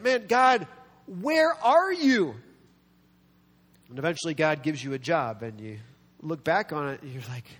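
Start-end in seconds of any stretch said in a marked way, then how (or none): 0.0-2.4 high, slow acted cry to God; desperate appeal. Jobless person calls out to God, acted desperate appeal. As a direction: call out, stretching "where are you".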